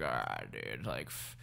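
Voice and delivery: low, soft, worn-out mumble; tired co-host. Retold or imitated. Teasing co-host about wrapping up the podcast, weary low imitation. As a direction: imitate someone too tired to carry on. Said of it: "You, like, get tired and you're just like".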